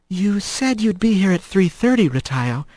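put-on a touch of a disappointed girlfriend; lightly voiced Kylie, chiding; mild reproach. Kylie scolds Rat for lateness.

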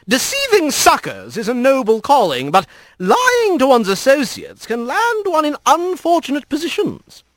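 high, arch creature voice; airy reproving fox; lecturing fox lord. Acted. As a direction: deliver the lesson in a high lordly lilt. Kit Fox lectures in story.

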